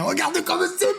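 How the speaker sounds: high, slow, voice cracking with emotion; tearful pride. Real, not acted. Streamer showing viewers his new studio, near tears. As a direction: choke up showing off what you built.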